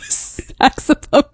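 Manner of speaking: high-pitched, laughing through the words; barely holding back real laughter. Real, not acted. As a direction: tell it while cracking up. Laughing at own embarrassing story.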